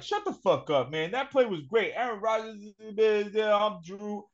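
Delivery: teasing a friend by imitating him; mocking Drew. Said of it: "I don't wanna hear you being like".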